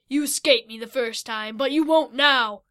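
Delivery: loud, menacing monster threat; horrible voice. Acted. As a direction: gloat over the trapped boys. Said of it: "in a horrible voice".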